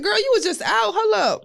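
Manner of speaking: high, mock-scolding friend; teasing scold. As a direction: playfully scold your sick friend. Friend voiced scolding sick friend.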